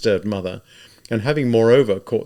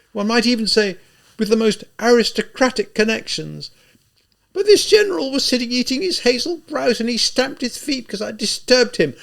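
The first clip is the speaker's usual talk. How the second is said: high, loud, overwrought lady's voice; a ruined gentlewoman clinging to her dignity, frantic; feverish proud widow; 12 semitones higher, 6 dB louder than usual. A narrator's reading.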